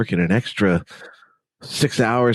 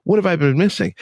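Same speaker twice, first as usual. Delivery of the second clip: high, bright-eyed wonder at a new find; excited discovery; 8 semitones higher than usual. Retold or imitated. Newcomers to meditation marvelling, excited and high. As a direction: gush like someone who just found meditation. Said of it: "get so excited".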